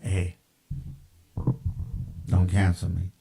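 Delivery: normal talking voice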